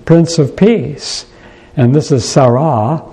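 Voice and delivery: usual speaking voice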